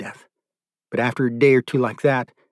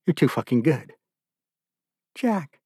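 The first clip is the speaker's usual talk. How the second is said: a touch of tears, softer and choked; crying; 4 dB quieter than usual. A narrator's reading. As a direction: through tears, barely holding on. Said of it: "He was crying".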